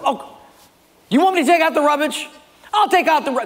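high, rapid indignant husband; hammed-up huffy outburst; acted annoyance. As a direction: fire back at the wife, voice shooting up. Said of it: "you took it out in".

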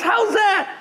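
very high-pitched mock amazement; baffled disbelief. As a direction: squawk the question as a stunned listener. Acted baffled pastor in joke.

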